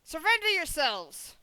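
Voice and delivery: high, loud proclamation of the offence; shouted command. Acted. Rider demands the group surrender for releasing prisoners, shouting. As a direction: shout it as a stern order. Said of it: "it, it, and shouts".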